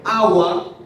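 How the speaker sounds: "agua" drawn out, first A loud and stressed. Shown as a demonstration. Teacher explains the prosodic accent of "agua", heavy first A. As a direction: put the weight on the opening A of "agua", slowly. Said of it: "se carga la voz en la primera A".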